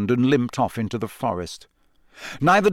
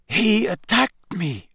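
Krum, higher and slow, muttering dazedly; 7 semitones higher, slower than usual. A narrator's reading. Krum to Dumbledore after the attack, dazed mutter. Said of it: "Crumb muttered".

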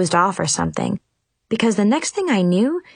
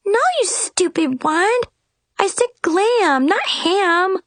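higher, exasperated scolding; 10 semitones higher than usual. A narrator's reading. Fairy godmother scolding her wand, higher exasperated scold. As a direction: tell off the wand like a naughty pet. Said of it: "Brianna scolded it".

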